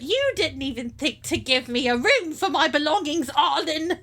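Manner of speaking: put-on loud scolding Cockney old lady; Cockney accent. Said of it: "I went Cockney again".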